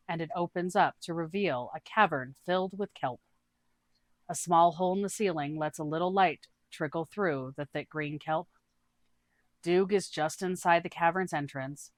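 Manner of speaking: ordinary delivery, nothing marked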